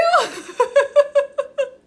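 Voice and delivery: loud, high laugh; joyful laughing. Real, not acted. Streamer overjoyed at seeing an old friend, laughing loudly. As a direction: burst of real, overjoyed laughter.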